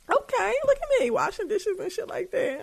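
high, teary half-laugh at herself; fake-sob with pride, laughing; mock-teary pride. Real, not acted. To listeners, proud of doing dishes: teary laughing pride.

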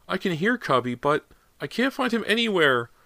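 put-on high tearful child voice; sobbing child. A narrator's reading. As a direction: sob like a frightened boy.

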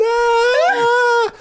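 high drawn-out mocking "aaah"; mocking child's cry. Little kid pointing at scared rider, mocking wail.

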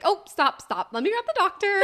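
high, loud, urgent nurse's stop. Retold or imitated. Retelling the nurse stopping the practice push, high and urgent. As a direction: mimic the nurse halting everything, half laughing.

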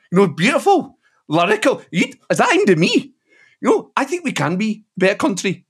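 a light take on a lyrical Glaswegian politician's voice; Anas Sarwar impression. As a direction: lift the pitch a little and make it sound earnest. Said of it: "those impressions there".

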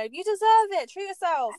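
high, indulgent egging-on; coaxing inner voice. Acted. Her inner voice tempting her to spend, coaxing.

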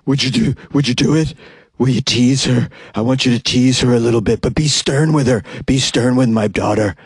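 creepy, husky put-on voice; husky caller voice. Caller urging the barber to tease her daughter, creepy husky voice. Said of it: "keep doing the one I'm doing".

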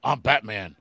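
low jokey Batman impression. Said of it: "doing an impersonation of Batman".

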